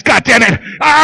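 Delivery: ordinary talk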